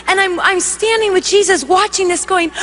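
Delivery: ordinary talk, nothing marked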